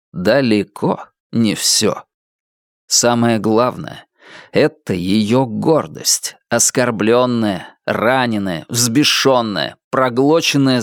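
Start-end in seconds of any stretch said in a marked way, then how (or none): none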